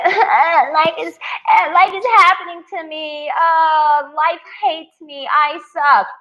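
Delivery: high, whiny victim voice; mock whining. Mocking a woman who plays the victim. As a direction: moan pathetically about life. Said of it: "a woman came at you".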